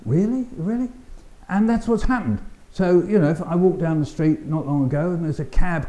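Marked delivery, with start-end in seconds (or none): 0.0-0.9 high rising double take; amazed. Retold or imitated. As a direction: disbelief going up in pitch. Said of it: "I said".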